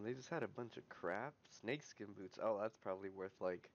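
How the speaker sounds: ordinary talk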